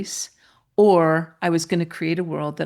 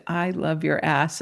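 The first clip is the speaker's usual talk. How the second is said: ordinary talk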